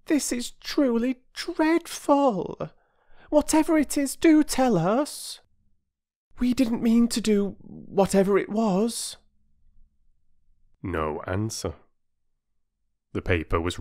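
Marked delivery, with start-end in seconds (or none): 0.0-9.2 put-on very high-pitched pleading little girl; plaintive, drawn-out high child voice; despairing. A narrator's reading. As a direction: plead slowly in childish distress. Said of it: "said Phyllis in despair".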